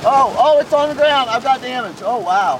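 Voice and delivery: high, loud, breathless storm report; excited shouting. Real, not acted. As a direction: shout it out as the tornado hits. Tornado crossing road beside chaser.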